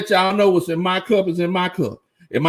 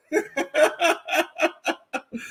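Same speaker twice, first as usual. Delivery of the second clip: laugh; 9 semitones higher, 6 dB quieter than usual. Real, not acted. Streamer laughing at chat comment.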